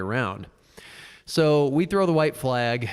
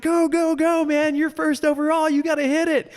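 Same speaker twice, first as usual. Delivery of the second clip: very high, louder urging; mock cheering buddy; 4 dB louder than usual. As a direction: yell it like a trackside buddy. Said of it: "He's like".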